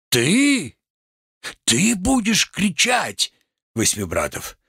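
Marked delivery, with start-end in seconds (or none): 0.1-3.3 high, slow theatrical outrage; outraged challenge. Acted. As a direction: stretch it upward in disbelief. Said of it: "Что же вы кричите?".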